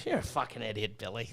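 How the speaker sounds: low, soft imitated muttering; retold dry insult under the breath; deadpan put-down. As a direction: drop low and quiet, unimpressed. Imitates partner's unimpressed remark, sitting there.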